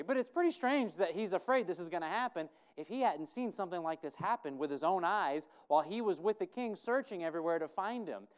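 normal talking voice

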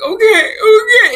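mock tearful. Sick actress answering her friend on the call, mock tearful. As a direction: imitate yourself wailing in reluctant agreement. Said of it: "I would start crying again".

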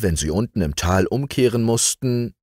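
ordinary delivery

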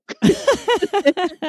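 a bright high burst of laughter. Real, not acted. Laughing at leaving her boyfriend, a high laugh. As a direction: laugh at your own punchline.

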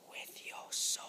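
unvoiced whispered menace; playful whispered threat. Dog voice trails to whisper.

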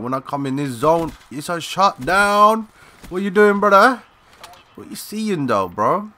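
real cocky hype, rising high; hyped taunt. Real, not acted. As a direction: crow over the kill. Taunting enemies after a shutdown.

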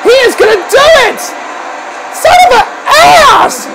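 high, alarmed commentary yell; outraged shout. Real, not acted. Commentator watching a choke slam coming, outraged shout. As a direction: yell it as disaster looms.

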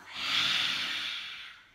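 long loud open-mouth exhale; loud breathy lion's breath exhale. Shown as a demonstration. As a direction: push the breath out loudly, tongue out. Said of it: "exhale out through the mouth".

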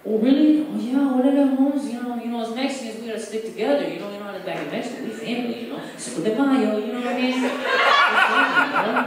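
high, loud hammed-up Chicano homie voice; mock Mexican accent. Comic pleading Mexican kinship in prison, mock accent. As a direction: sell the brotherhood like a homie. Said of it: "I'm fucking Mexican".

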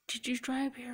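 hushed, concerned whisper; whispered question. Retold or imitated. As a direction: ask it softly like a worried stranger. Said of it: "She's like".

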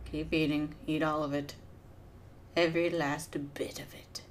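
whispered, coaxing the victim; scheming whisper. Pretend. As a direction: murmur it like a sly poisoner. Willing the wizard to eat.

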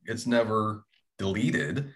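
normal talking voice

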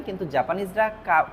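usual speaking voice

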